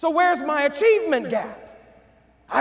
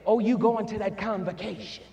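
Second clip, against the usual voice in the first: tender home voice; 5 semitones lower, 4 dB quieter than usual. Retold or imitated. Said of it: "stopped you and said".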